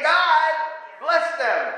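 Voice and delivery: two-part prayer phrasing; separate words. Shown as a demonstration. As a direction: split "God, bless them" into two clear beats. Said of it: "It sounds like one word. No, you're saying".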